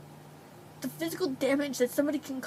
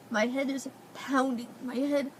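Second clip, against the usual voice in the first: usual speaking voice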